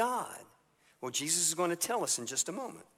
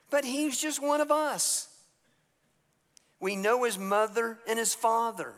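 eager townsfolk; 6 semitones higher than usual. Retold or imitated. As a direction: brag like a neighbour who knew him as a boy. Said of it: "And they're saying".

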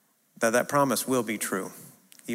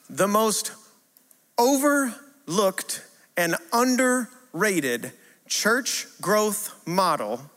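very slow, higher, spaced out with pauses; slow, deliberate announcement; 6 semitones higher, much slower than usual. Real, not acted. Preacher announcing his key statement, slowly and deliberately. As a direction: announce it phrase by phrase, drawing it out.

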